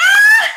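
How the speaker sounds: very high, loud mock shriek; mock scream. Gossiping Scorpio mocking a butt, shrieking. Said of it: "She like".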